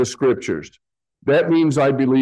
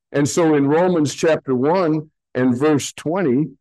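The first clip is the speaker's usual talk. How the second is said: normal talking voice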